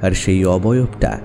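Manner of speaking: ordinary delivery, nothing marked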